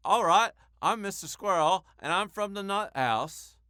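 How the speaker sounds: lightly voiced Southern squirrel; Southern accent. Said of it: "Is that Southern?".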